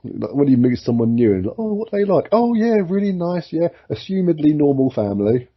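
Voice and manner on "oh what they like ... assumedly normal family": high-pitched mock small talk; lifted, high voice for polite chit-chat; chatty acquaintance. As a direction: play an approving gossip, pitched well up. Acts out chat about a new acquaintance.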